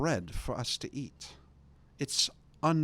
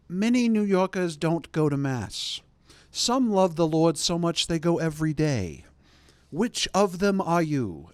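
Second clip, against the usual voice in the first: louder, higher preaching challenge; fiery priest; 6 semitones higher, 7 dB louder than usual. Retold or imitated. Priest challenging the New Yorkers, fiery preaching. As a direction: thunder it at the pews like a fiery priest. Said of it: "This guy comes in and is like".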